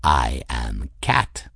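low, slow, self-satisfied cat; smug. A narrator's reading. Cat answers the girl who calls him impossible, smug. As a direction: state it deep and slow, as a superior being. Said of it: "Raoul said smugly".

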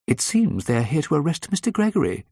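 high-pitched, composed receptionist; unflappable receptionist. A narrator's reading. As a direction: report it unruffled in a high voice. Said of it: "said the unflappable Missus McDowd".

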